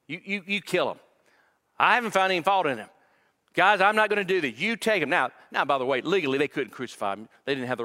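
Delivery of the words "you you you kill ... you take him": higher and louder, quick; exasperated protest. Retold or imitated. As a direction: push them away in frustration. Pilate refusing the crowd.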